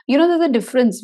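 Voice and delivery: ordinary talk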